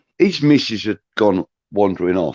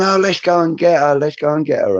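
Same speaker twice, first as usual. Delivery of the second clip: eager tipsy insistence; 8 semitones higher than usual. Retold or imitated. Tipsy wife urging a late drink.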